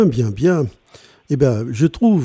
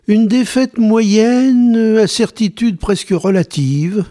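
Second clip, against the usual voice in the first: mock high emphasis; 8 semitones higher than usual. Mocking poll option.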